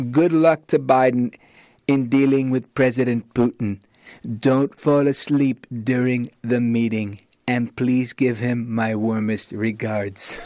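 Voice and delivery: a hint of Trump signing off; Trump impression. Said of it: "I don't have the best Trump".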